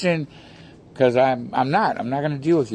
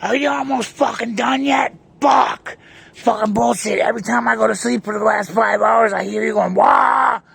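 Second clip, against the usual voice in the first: high shrill-free furious shout of an annoyed neighbor; mock furious neighbor shouting; angry neighbor yelling; 7 semitones higher than usual. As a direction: yell it like she's fed up and woken. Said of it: "this is what this lady says".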